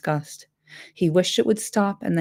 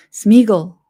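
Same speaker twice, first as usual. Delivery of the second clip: louder call of the name; calling a bit louder into the dark; a little louder; 3 semitones higher, 9 dB louder than usual. Acted. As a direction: call the name out more strongly. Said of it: "he said a little louder".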